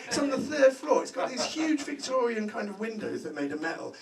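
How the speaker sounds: ordinary talk, nothing marked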